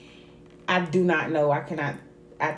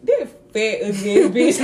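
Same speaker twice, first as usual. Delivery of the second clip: laughing while quoting; 4 semitones higher, 8 dB louder than usual. Retold or imitated. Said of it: "It was like".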